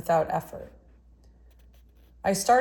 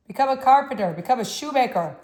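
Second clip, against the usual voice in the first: louder and higher, hammering each word; a hint of Russian accent, pushed hard; strong, forceful voice; 6 semitones higher, 7 dB louder than usual. A narrator's reading. Artist warning the boy off art, loud and forceful. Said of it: "He had a strong voice".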